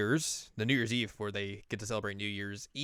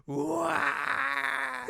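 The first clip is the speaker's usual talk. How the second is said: panicked yelp. Acted. Naoto sees everyone rising from the floor: high panicked yelp. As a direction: yelp like you've seen the dead rise.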